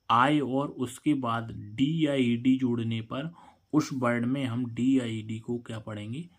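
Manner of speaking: normal talking voice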